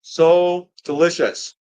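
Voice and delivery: slow and savoring. Real, not acted. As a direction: linger over the taste. Tasting pizza.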